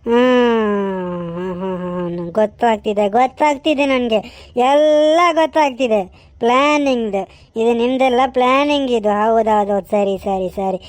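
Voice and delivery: low, bitter muttering son; a grown son brooding low over being thrown out; sulking son. Acted. A low, sulking son told to leave his mother's house.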